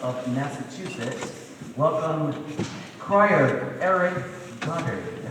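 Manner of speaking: building announcer intro, slow at the end. Acted. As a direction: announce the next performer grandly. MC introduces next crier.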